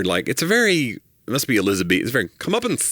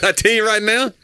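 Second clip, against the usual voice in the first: higher, louder drawl, echoing him; mock Southern drawl; 12 semitones higher, 3 dB louder than usual. Cohost teasing host by echoing his Southern drawl. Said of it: "You started with".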